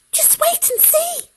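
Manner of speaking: a clever mouse talking fast for his life; squeaky high mouse; tiny mouse. Acted. Mouse beckons from the tree.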